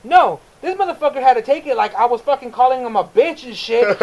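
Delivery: usual speaking voice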